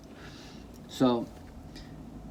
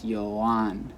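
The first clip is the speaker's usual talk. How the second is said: YOAN said yo-AHN, end stressed; stress on second syllable. Shown as a demonstration. Teacher saying own name properly, Yoan stressed on AHN. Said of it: "The stress falls on the second syllable".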